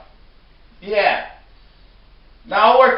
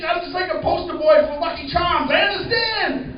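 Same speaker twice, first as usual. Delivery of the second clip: normal talking voice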